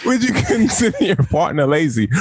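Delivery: put-on high country-bumpkin accent with laughter; mock accent. Winding up the co-host with the question, mock accent. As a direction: keep up the bad accent, laughing. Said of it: "You fucking country bumpkin".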